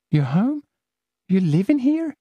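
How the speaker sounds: can't believe it; incredulous girl. Acted. Celia incredulous.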